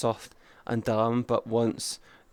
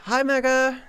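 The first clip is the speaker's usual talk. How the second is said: bright greeting; 12 semitones higher, 3 dB louder than usual. Real, not acted. Greeting a chatter.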